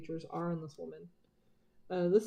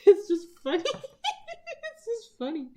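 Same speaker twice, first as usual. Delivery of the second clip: laughing; 14 semitones higher, 8 dB louder, faster than usual. Real, not acted. Laughing at own fake accent.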